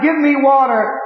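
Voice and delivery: high, loud anguished begging; crying out for water. Retold or imitated. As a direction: cry out from the flames. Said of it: "And he cries and he cries".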